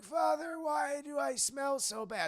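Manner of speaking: put-on small kid voice. Possessed boy asking the priest, in a high child voice. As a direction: ask it like a sad little boy. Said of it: "the kid starts talking like normal".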